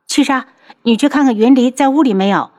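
quick, high-pitched order; calling outside. A narrator's reading. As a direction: send a servant off briskly. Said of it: "楚清瑶对着外面道". (对着外面急急吩咐)

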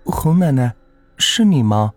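put-on high girlish, probing question; tentative call. A narrator's reading. Girl calls to a dim figure on the cliff, tentative high call. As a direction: call out unsure who is there. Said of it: "小芝试探着喊道".

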